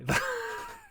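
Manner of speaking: high burst of laughter. Real, not acted. Laughs at own lads story.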